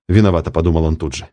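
ordinary delivery

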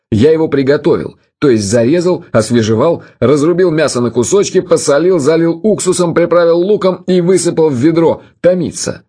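savouring it, pitch lifting as the list builds; with relish. A narrator's reading. Museum host tells guests how he prepared the lamb, with relish. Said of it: "Он с наслаждением описывал свои действия".

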